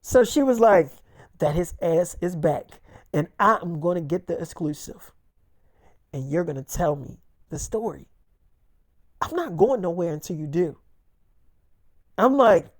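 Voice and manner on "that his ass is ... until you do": low, soft, measured resolve; quiet insistence. Retold or imitated. Podcaster demanding the exclusive story, quiet insistence. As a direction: lightly imitate someone quietly standing firm.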